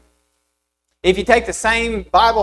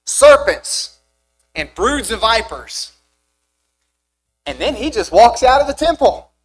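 loud, rising sermon build through the woes; preaching at full force; 5 semitones higher, 6 dB louder than usual. Acted. Preacher to congregation, listing Jesus' woes, loud and forceful. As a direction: hammer each insult home from the pulpit.

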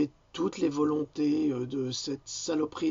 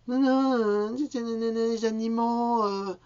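high, very slow whiny imitation; hammed-up imitation of Louloute objecting; mock protest; 9 semitones higher, 5 dB louder, slower than usual. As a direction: parody her pleading for the animals.

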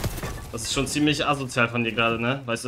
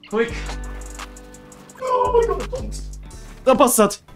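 excited outburst; slower than usual. Real, not acted. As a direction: yelp of delight at the play. Sudden in-game success. (aufgeregter Jubelschrei)

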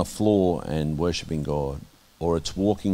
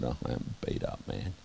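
exhausted; 8 semitones lower, 12 dB quieter than usual. Retold or imitated. Weary reply retold to friend.

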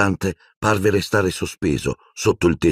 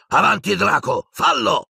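very high, strained command; urgent yell. A narrator's reading. Death Eater orders Draco to kill, urgent yell. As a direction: goad him with a high shout. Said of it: "urlò il mangiamorte a un tratto violento".

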